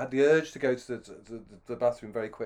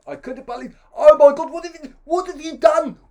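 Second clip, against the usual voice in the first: high, loud, outraged South African; hammed-up furious South African colonial; South African accent; 15 dB louder than usual. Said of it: "how do you do South African?".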